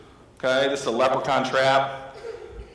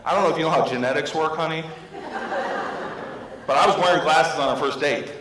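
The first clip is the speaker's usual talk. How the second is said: mock indignant husband, a touch raised; taking it personally; 3 semitones higher than usual. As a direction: argue back, playing up mild offence. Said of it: "starting to get, like, take it personal".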